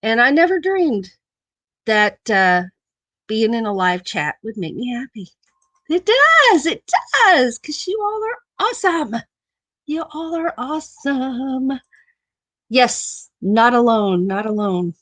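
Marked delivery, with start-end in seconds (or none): 5.9-11.8 excited. Real, not acted. Gushing to her viewers.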